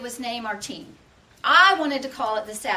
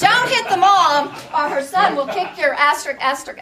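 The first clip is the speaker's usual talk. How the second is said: mock threat; 3 semitones higher, 6 dB louder than usual. Punchline warning to opponent.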